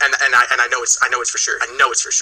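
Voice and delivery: normal talking voice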